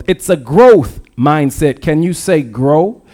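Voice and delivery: ordinary delivery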